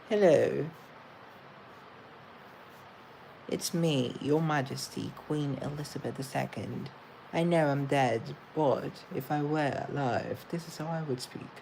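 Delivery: British royal accent; proper Queen's English accent; Queen Elizabeth the Second. Shown as a demonstration. Playing the late monarch for viewers, British accent. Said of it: "This is sort of like my British accent".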